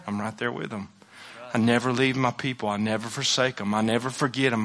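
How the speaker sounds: gentle divine reassurance. Acted. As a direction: reassure them quietly, as God answering. Voices God's answer of reassurance.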